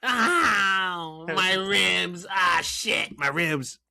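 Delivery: high whiny voice clutching hurt ribs; Rey Mysterio pained voice. Retold or imitated. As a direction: whine in pain like you're being squeezed hard. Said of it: "make fun of him with that voice".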